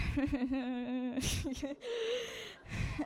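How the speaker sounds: a long laugh that breaks into crying; choked laughing sob; tearful laugh. Real, not acted. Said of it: "I'll try not to cry".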